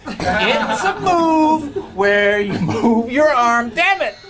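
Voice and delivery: slow, drawn-out line bursting into a high cry; mock exasperation. Panelist stretching out a timed speech about robots, mock exasperation. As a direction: stretch it out, then yelp the curse.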